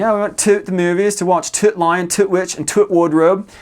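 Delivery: put-on high, loud comic Newcastle accent; mock Newcastle accent. Retelling a British comedian's joke, exaggerated Newcastle accent. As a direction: send up Newcastle speech, every "the" a punched "toot". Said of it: "makes fun of Newcastle by saying".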